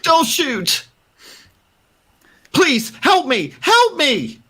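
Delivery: high, desperate begging; a terrified man facing armed strangers; pleading for life. Acted. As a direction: beg them not to shoot, hands up. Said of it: "puts his hands up and is like".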